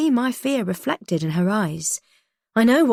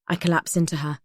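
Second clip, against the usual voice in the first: ordinary delivery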